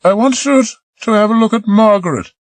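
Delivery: a hint of Marie, coaxing; lightly voiced young woman. Marie coaxing Peter to come below, lightly voiced woman.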